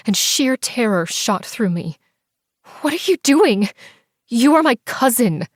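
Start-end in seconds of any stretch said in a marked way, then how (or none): 2.8-5.5 high, loud horrified plea; terrified protest. Acted. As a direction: recoil in terror from your cousin. Terrified by cousin's attack.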